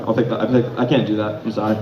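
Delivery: ordinary talk, nothing marked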